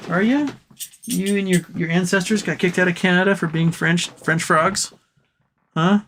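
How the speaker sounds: high, teasing pet voice; ribbing his dog Monkey in a high pet voice. Real, not acted. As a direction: tease the dog in a sing-song.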